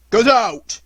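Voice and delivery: loud, menacing bark; angry fisherman shouting at a dog; threatening shout. Acted. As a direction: drive the dog off. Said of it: "shouted the fisherman threateningly".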